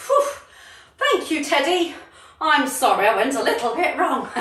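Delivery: ordinary talk, nothing marked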